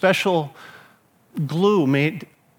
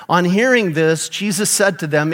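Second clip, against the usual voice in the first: normal talking voice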